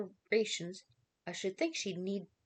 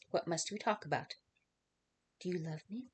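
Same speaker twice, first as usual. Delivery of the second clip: ordinary delivery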